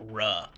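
ruh with a curled English R. Shown as a demonstration. Showing the English R sound. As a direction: say ruh, tongue pulled back. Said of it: "cannot say the R".

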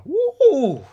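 quick loud high whoop; excited whoop. Real, not acted. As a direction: burst out with a cheer. Preacher whoops after point.

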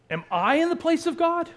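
high, loud, indignant question; angry Jacob. Acted. Husband rebuking his wife's demand, angry and indignant. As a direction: fire back an outraged rhetorical question. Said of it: "Jacob's angry, rightfully angry".

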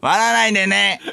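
put-on very high, crude child-star caricature; 芦田愛菜. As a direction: squeak it out as a fake little girl. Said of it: "芦田愛菜さんのモノマネのモノマネ？". (芦田愛菜のものまねのものまね、甲高く)

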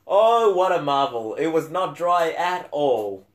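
mock praise, dripping irony; sarcastic, overdone enthusiasm. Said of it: "I say that sarcastically".

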